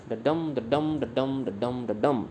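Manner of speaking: "डडम" chanted with a light first and a heavy second syllable; da-DUM beat. Shown as a demonstration. Teacher chants the iambic beat for the students. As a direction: chant the iamb like a drumbeat. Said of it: "तो ये आ रही ना beat".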